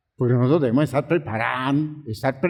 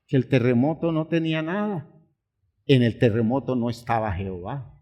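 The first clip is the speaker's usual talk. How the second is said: ordinary talk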